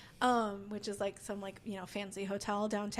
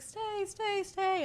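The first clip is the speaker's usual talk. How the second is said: pleading friends; 9 semitones higher, much slower than usual. Retold or imitated. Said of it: "everyone's like".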